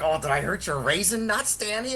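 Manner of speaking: lightly imitated sneering taunt, up on Danny; mock the friend's jeering question; a touch of a smug bully voice. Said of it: "he's being a dick about it".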